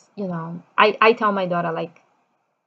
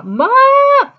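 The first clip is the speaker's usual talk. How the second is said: high, loud yell of mom; imitated kid yelling; 9 dB louder than usual. Kids summoning mom from their room, loud yell. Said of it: "all my kids yell".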